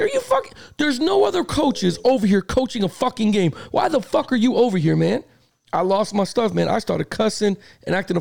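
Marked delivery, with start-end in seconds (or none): 0.0-5.2 mock rant. Retold rant at referee.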